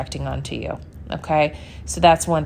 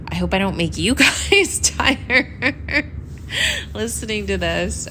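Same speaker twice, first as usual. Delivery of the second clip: higher, breaking into laughter; amused apology; 4 semitones higher than usual. Real, not acted. Podcaster worrying listeners will get sleepy, laughing. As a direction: laugh through the end of the words.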